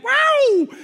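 long, very high mock rage howl; sustained full-volume wail; loud high roar. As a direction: explode in a drawn-out comic yell. Acts out being hijacked by rage.

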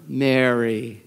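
"Mary" drawn out low and level; low intonation. Shown as a demonstration. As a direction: say "Mary" slowly in a low register. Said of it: "with an intonation that only Jesus used".

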